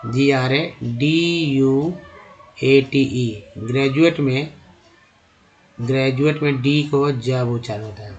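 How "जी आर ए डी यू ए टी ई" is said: letter by letter, very slow; spelled out slowly. Shown as a demonstration. Teacher spelling a word slowly for students. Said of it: "graduate होता है जी आर".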